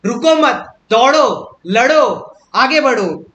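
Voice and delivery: rousing motivational coach in full flow; motivational speech. Acted. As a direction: fire them up with punchy, urgent commands. Fiery motivational poem recital and explanation.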